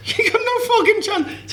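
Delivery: high, slow, laughing refusal; laughing defiance. Retold or imitated.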